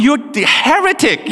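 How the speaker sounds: high, hammed-up denunciation; mock accusation. Father to son claiming to be Jesus, mock accusation. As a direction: jokingly condemn the child.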